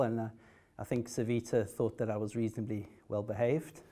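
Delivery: ordinary talk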